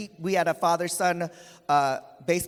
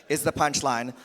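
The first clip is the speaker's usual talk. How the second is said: ordinary talk, nothing marked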